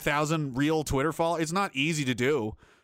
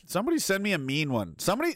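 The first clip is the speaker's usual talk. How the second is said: ordinary talk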